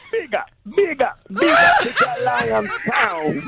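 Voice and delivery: high, hollered island toasting; mock island DJ accent. Caller playing radio DJ for the show, island accent. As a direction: toast it like a dancehall DJ, laughing. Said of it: "That's a good accent".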